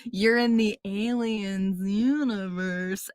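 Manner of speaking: slow, stretched-out mock announcement; dramatic reveal. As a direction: draw each word out for the big reveal. Imitating the developers' big reveal.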